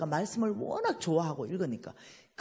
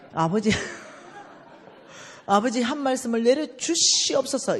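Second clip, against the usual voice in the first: loud, higher pleading call to God; begging God aloud for a word; fervent prayer cry; 4 semitones higher, 5 dB louder than usual. Retold or imitated.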